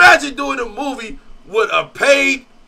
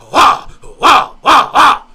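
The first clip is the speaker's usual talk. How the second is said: loud mouthed gunshot noises; mock gunshots; 3 semitones higher, 9 dB louder, slower than usual. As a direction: fire off imitation shots. Imitating gunshots.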